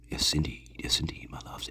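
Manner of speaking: soft whisper to the viewers; hushed whisper, my loves. Acted. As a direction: breathe it like a secret to fans. Intimate aside to viewers.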